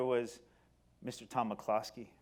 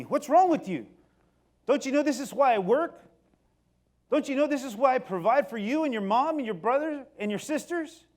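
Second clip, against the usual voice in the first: higher, louder angry father; dad yelling; 10 semitones higher, 8 dB louder than usual. Retold or imitated. Dad yelling at son about school, angry and loud. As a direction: scold like a furious dad. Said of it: "he starts yelling at me".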